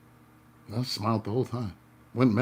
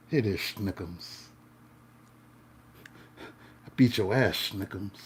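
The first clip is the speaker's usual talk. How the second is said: slow, softer syrupy teasing; mock sweet talk; 4 semitones lower, 4 dB quieter, slower than usual. To the angry driver, a slow, mock-sweet taunt. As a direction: coo at him like a sweetheart. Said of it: "just blowing kisses at him".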